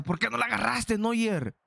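high-pitched protest at the keeper; exasperated cry. Real, not acted. Gamer scolding his keeper Neuer, exasperated high cry. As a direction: wail the question up high in disbelief.

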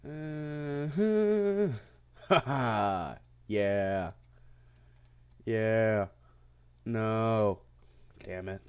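ordinary talk

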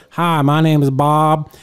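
put-on loud, slow, marble-mouthed old-timer; Bob. Old man introducing himself to the room, mock slow drawl. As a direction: imitate an old Florida man drawling loudly. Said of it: "talks with marbles in his mouth".

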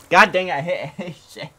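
loud cry ending in a laugh; startled outburst. Real, not acted. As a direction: yelp at your own mistake. Hits wrong button, cries out.

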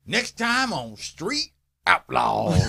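slow, goofy drawn-out announcer read; stupid promo voice. Shown as a demonstration. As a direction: say the promo as dumb as you can. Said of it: "I've learned to just say it stupid".

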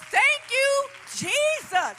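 loud, high, jubilant thanks to Jesus; joyful praise. Real, not acted. Testimony erupts into loud praise.

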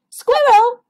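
high, loud call up a tree. Acted. Porcupine calling up to Squirrel's nest, loud high call. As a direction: call her name up into the branches.

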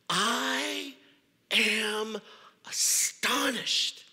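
slow, drawn-out astonishment; sharp astonished. Acted. Paul rebuking the Galatian churches, sharply astonished. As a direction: let each word land in shock. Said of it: "the tone is very sharp".

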